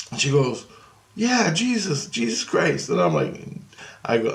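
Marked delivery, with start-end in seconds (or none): 1.2-2.9 put-on high child voice, coming down; twelve-year-old girl. Little girl answers the man, high child voice. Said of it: "she talking like she's twelve years old".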